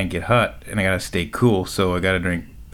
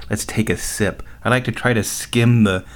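usual speaking voice

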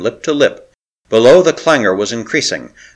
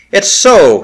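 sudden hope; 9 semitones higher, 6 dB louder than usual. Acted. Sudden hope breaks through.

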